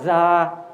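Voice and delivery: 자 drawn out with a buzzing Z onset; voiced English z at the start of 자; z-like consonant. Shown as a demonstration. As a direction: demonstrate 자 as a long "zaa". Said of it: "제트 발음 비슷해요".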